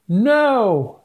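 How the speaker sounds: high, loud cry of no. Acted. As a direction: cry out to stop the sword. Anna leaps to save sister.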